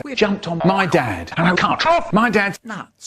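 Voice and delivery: normal talking voice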